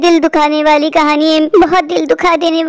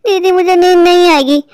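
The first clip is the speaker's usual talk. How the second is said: normal talking voice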